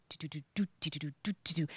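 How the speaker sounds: soft low do-do drumming; mimicking an Irish drum; drum beat imitation. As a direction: tap out the bodhrán rhythm softly.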